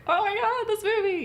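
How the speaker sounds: high, loud gushing; excited gush. Retold or imitated. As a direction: gush about the movie. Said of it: "And that's where I started to get like".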